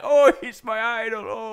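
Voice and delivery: high-pitched swooning fan; adoring fan. Acted. Co-host imitating everyone who likes PewDiePie, swooning high. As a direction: gush like a mock fan.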